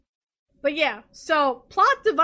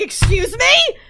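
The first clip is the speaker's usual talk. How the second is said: the detective can't believe what he just heard; incredulous, raised-voice double take; shocked "excuse me"; 8 semitones higher, 7 dB louder, slower than usual. Retold or imitated. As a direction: jump up high and loud in disbelief. Detective reacts to shocking news.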